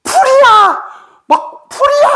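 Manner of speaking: high, loud, drawn-out cries of discovery; acted awestruck shout. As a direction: cry out in overwhelmed amazement. Retells friend's cry of awakening.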